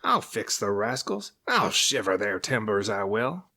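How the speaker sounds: a touch of serpent fury; angry vow. A narrator's reading. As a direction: vow revenge. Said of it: "snarled the Serpent".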